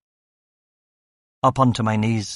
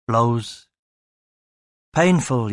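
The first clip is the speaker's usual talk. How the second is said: plain reading voice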